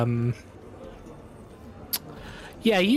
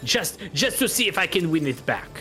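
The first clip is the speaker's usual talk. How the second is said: put-on lightly accented gambler, eager and pleading; a hopeful loser pushing for one more round; Bretonnian accent; 8 semitones higher than usual. Gambler pleads with Arno to win his money back, Bretonnian accent.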